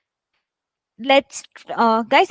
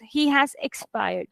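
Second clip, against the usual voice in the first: slow, softer, somber delivery; sad sentence; 6 dB quieter than usual. Shown as a demonstration. Teacher shows how to read news of a death, sad and subdued. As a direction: let the news sink, slowly and softly. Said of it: "if it's a sad sentence".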